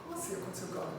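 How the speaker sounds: faking sleepy. Pretend. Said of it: "eu fingi que eu tava lá".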